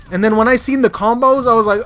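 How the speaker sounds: rising loud excitement; building up to the shock; excited. Real, not acted. Streamer recalling the opponent's combos, excited and loud. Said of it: "excited retelling".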